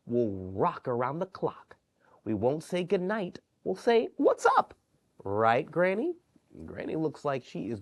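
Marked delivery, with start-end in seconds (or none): 2.2-4.7 What's up squeaked much higher and louder; gleeful child voice; playful child. Acted.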